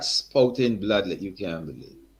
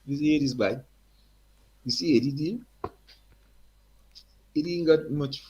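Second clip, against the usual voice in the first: put-on high, hearty Guyanese accent; 5 semitones higher than usual. Eddie's father greets the young singer in a high Guyanese accent. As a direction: greet him like an old Guyanese man. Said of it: "Strong accent again".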